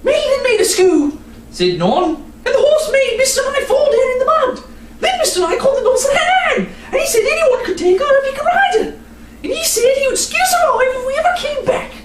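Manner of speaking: high, fast excited tattling; little sister blurting it all out; excited child. Acted. Nan excitedly tells the story.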